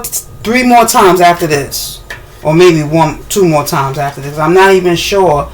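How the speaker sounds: ordinary talk, nothing marked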